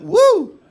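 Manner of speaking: high, loud "woo"; imitated audience whoop. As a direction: whoop like an excited audience member. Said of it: "The one who went".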